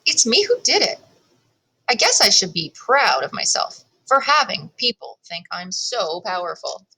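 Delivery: ordinary delivery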